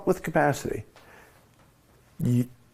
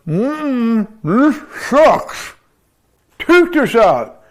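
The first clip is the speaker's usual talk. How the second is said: higher, louder whiny patient, slow on the last words; complaining patient; 7 semitones higher, 7 dB louder, much slower than usual. Acted. Ventilated patient begging the doctors to remove the tube, higher and louder. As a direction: moan it like a fed-up ventilated patient. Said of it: "he goes".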